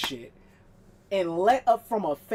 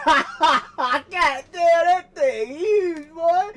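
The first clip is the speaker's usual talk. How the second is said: laughing amazement; 6 semitones higher, 7 dB louder, much slower than usual. Real, not acted. Amazed at the huge old handheld.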